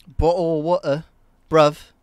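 loud, hammed-up British slang accent; mock British accent. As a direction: put on a cheeky British accent. Mimics a British accent for chat.

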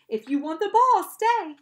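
high, very loud command; ordering. A narrator's reading. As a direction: shout a firm order at a dog. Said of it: "Annabeth ordered the monster".

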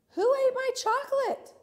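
hammed-up shocked question; outraged cry. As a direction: demand it in a high, scandalised voice. Poem's outraged question, read expressively.

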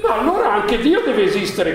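high, loud astonished exclamation with a laugh; excited cry. Acted. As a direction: blurt it out in amazed delight. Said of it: "gridò il principe".

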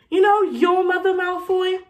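high, loud opening jab at a rival; challenging comeback. Acted. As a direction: throw the insult back, pitched high. Harry throws the insult back.

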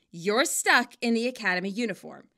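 ordinary delivery, nothing marked

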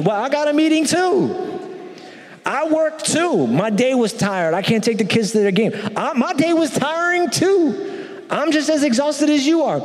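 high exasperated mock wife. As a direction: play the tired wife snapping back, high. Acted spousal argument.

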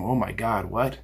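hushed, mock gasp of disbelief; a skeptic watching his video, muttering in shock; mock stunned onlooker. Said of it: "watching going".

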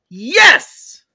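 one loud, high burst; screamed command. Acted. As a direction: shout down the child's refusal. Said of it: "You don't need to scream at me".

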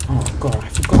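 ordinary talk

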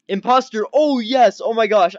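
loud, high burst of excitement; excited triumph. Real, not acted. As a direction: celebrate a correct guess. Celebrates voting out the imposter.

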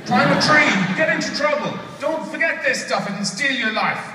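high, emphatic mock outburst; scolding dad. Dad sending son away from games, exasperated and high. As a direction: order the boy out in exasperated bursts.

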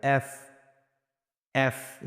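এফ with air blown through the f; isolated, carefully modelled এফ; correct letter F. Shown as a demonstration. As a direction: teeth on lip for the end of এফ. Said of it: "মুখ দিয়ে বাতাস বের হবে".

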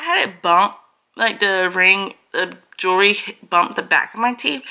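speaking with fingertip on lip; finger touching lips. Real, not acted. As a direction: hand at mouth. Seen 0: Fingertip rests on lower lip throughout; hand drops away by 4.5.

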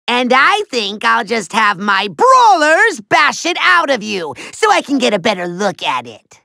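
ordinary talk, nothing marked

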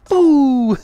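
high, loud teasing boo; mock scare shout; playful boo. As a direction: spook them for fun. Jump-scaring the enemy.

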